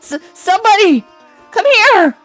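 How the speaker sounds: high, loud, panicked call; stammer, then scream for someone; shouting for help. Acted. Girl alone with the killer, shouting for help. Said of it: "shout a little louder".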